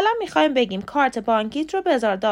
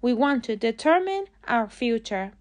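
a slow, clear example sentence; slow model sentence; much slower than usual. Shown as a demonstration. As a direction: say each word slowly for learners. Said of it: "باید بگیم". (جملهٔ نمونه، آهسته و شمرده)

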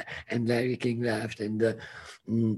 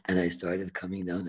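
ordinary delivery

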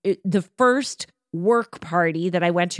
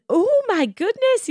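put-on high, slow Irish-accented gasp of delight; a light Irish accent, drawn out and lifted high; imitating Irish accent. The Irish boss at her party receiving the wine, mock Irish accent. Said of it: "in her Irish accent".

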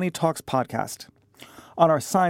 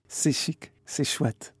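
French chef; 2 semitones lower, 6 dB quieter, slower than usual. Pretend. Mock French aside, softly.

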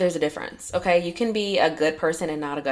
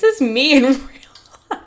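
laughing; 7 semitones higher, 8 dB louder than usual. Retold or imitated. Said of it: "I was like".

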